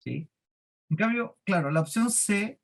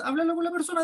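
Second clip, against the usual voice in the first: "háblalo con la persona" said much higher, pushed up; emphatic advice; 12 semitones higher, faster than usual. Shown as a demonstration. Teacher urging a coworker, "háblalo con la persona" much higher. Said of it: "Ojo, le digo que no me siga contando y que há".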